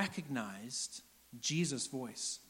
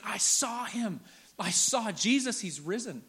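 higher, louder, breaking the news; excited announcement; 4 semitones higher, 3 dB louder, slower than usual. Acted. Mary barges in on the waiting disciples, excited announcement. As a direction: burst in with amazing news. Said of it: "Mary comes barging in and she's like".

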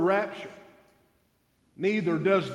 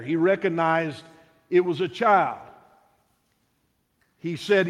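ordinary delivery

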